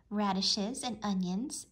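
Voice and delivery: ordinary delivery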